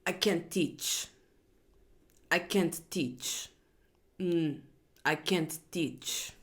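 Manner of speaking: slow, soft mock German-accented teacher. Reader imitating a weary teacher in German accent. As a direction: drag out I can't teach in a put-on German accent. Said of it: "a Russian accent or a German accent".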